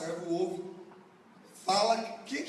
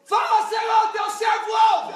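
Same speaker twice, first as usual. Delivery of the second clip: much higher, very loud roar; full-force shout; 11 dB louder than usual. Shown as a demonstration. Congregation urged to answer God with thunder, huge shout. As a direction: bellow it with all your strength. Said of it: "mais força, mais estrondo".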